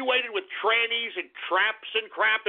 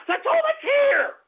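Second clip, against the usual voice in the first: much higher, loud outburst; exasperated shout; 12 semitones higher, 3 dB louder than usual. Real, not acted. Streamer snapping back at a viewer, with a loud, high exasperated shout. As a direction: blow up at the question. Defending reading the names.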